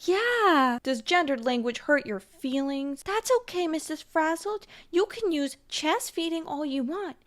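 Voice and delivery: hammed-up gentle-parenting tone, like scolding a toddler; syrupy mock patience aimed at the critic; mock condescending sweet voice. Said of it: "this is a very condescending way to talk".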